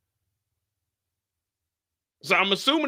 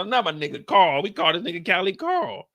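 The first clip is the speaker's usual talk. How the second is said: ordinary delivery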